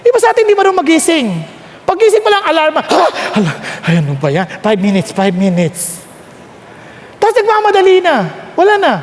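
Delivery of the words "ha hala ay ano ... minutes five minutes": low, mumbling half-asleep plea; lower, sleepy grumble; groggy sleeper. Pretend. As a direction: beg the alarm for five more minutes, drowsy. Acted groggy sleeper at the alarm.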